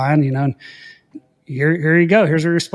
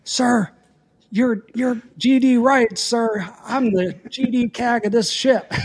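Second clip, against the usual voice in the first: retold screaming; 8 semitones higher, slower than usual. Ship's officer screaming at a drunk Air Force pilot. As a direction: bellow it like an offended ship's officer. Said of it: "screaming at me".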